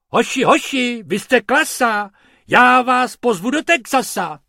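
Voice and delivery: loud, high, excited call. A narrator's reading. Tourist cheering on the working rabbits, calling out loudly. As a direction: shout your praise over the noise. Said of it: "do toho pan Pumpernagel volal".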